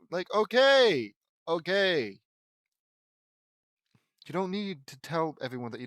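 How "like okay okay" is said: loud, slow, exasperated cut-off; a drawn-out, louder okay. Real, not acted. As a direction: stop the line, fed up. Cuts off the fanfic, exasperated.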